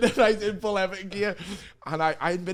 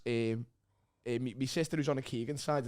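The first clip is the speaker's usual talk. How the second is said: normal talking voice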